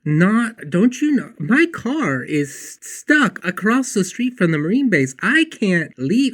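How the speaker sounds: high, loud, slow exasperated rant; frustrated inner rant. Retold or imitated. Waiting on a colleague who won't stop talking, exasperated. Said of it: "starting to get a little bit frustrated".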